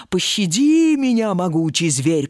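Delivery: plain reading voice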